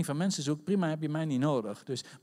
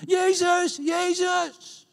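high, loud, drawn-out desperate cry; calling out; 9 dB louder, much slower than usual. Acted. Blind men keep calling to Jesus, loud high cries. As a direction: cry out the name far above your usual pitch. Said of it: "maar die blijven maar roepen".

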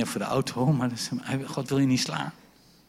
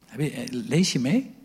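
ordinary delivery

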